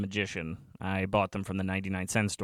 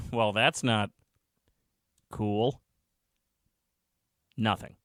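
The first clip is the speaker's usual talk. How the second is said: usual speaking voice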